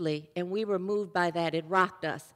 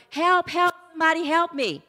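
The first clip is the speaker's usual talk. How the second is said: high-pitched acted cry for help; yelling for help; 6 semitones higher than usual. Fallen hiker yelling for somebody, acted cry for help. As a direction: call out as if dangling from a branch. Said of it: "he was yelling".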